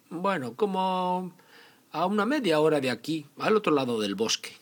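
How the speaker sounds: put-on high, loud childlike voice; little girl voice. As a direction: answer like an innocent child. Caperucita tells the wolf the way.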